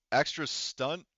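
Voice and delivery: ordinary talk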